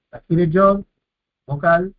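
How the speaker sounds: normal talking voice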